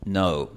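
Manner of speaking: no said as the English diphthong, gliding from O toward U. Shown as a demonstration. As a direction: glide the English no, not a pure Spanish O. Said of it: "como en la palabra inglesa". (la palabra inglesa no con diptongo)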